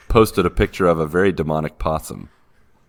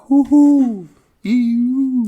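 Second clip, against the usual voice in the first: high hooting owl call; owl hoots; 5 dB louder, much slower than usual. Pretend. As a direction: hoo like an owl. Mimicking owl bird calls.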